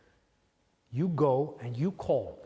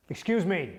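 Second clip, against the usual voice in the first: higher, sharper bid for attention; insistent call; 6 semitones higher, 3 dB louder than usual. Acted. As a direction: call out to someone who ignored you. Calls out after being ignored.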